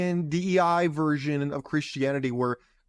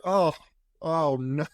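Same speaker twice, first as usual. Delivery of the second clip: normal talking voice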